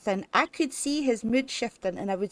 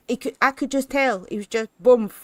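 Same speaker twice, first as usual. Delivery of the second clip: ordinary delivery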